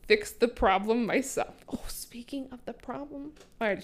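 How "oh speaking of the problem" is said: unvoiced whisper aside. Real, not acted. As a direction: drop to a whisper.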